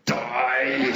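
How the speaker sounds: low, loud, growled "Die!"; the murderous voice inside your head; inner rage voice. Retold or imitated. Checkout customer's silent scream at a dawdler, low and loud. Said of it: "on the inside, you're going".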